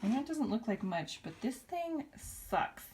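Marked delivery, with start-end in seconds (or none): 1.2-2.8 slow, high, teasing emphasis; playful drawl. Real, not acted. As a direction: draw it out as a cheeky pun. Joking pun about vacuum.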